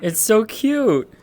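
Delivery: cooing. Real, not acted. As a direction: coo it like at a puppy. Cooing over cute avatar.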